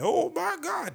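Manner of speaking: very high, loud, slow falsetto squeal; stretched falsetto shriek; Laughing Lenny impression. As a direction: shriek it out like a giddy comic. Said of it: "That's what he said".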